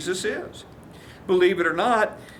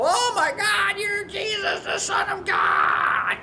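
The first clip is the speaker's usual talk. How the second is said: shrieking demon; 11 semitones higher, slower than usual. Retold or imitated. Preacher voicing the first demon as it screams at Jesus. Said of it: "the first demon he got rid of".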